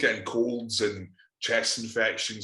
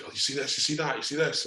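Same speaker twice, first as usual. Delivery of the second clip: higher nagging parent voice, mock; 6 semitones higher, faster than usual. Mocking the parents' generation who fret, higher nag. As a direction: imitate fussing parents, higher. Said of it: "they're like".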